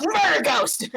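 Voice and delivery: high, loud mock title; gleeful announcement. To the friend, dubbing it with mock glee. Jokingly naming the ghost.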